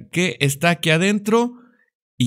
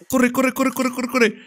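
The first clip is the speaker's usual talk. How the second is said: high frantic run run run; urgent and high while fleeing; being chased; 8 semitones higher than usual. Real, not acted. Fleeing the monster in game.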